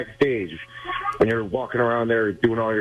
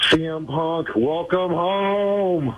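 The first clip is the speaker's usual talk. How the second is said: higher, long drawn-out welcome; fan's welcome; 6 semitones higher, much slower than usual. Real, not acted. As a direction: stretch out the last word like a ring announcer. Caller greets returning wrestler on air.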